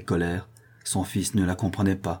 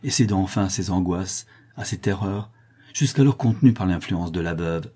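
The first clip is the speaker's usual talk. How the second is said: ordinary delivery, nothing marked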